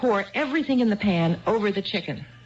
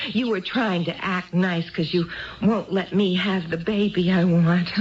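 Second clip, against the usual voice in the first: ordinary talk, nothing marked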